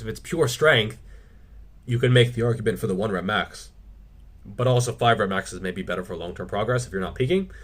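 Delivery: ordinary delivery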